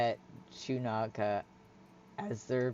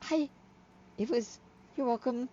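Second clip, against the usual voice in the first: higher, halting, bashful voice; awkward Hyla; 8 semitones higher, 6 dB quieter than usual. Acted. Hyla asking friend to catch up tonight, bashful. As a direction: fumble shyly between small laughs.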